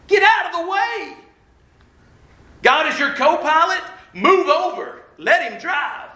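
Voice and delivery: high, urgent command; preacher urging the church to let God lead; preaching exhortation. Real, not acted. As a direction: drive it home to the crowd.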